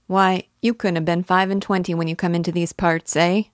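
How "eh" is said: "eh" as a tacked-on question. A narrator's reading. Clerk asking the weaver his age, questioning "eh". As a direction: turn "eh" up into a query. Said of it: "the change to a questioning tone".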